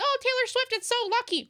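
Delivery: high, squeaky mock of gushing fans; shrill parody of Swifties. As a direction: ridicule them in a high silly voice. Mocking what people say.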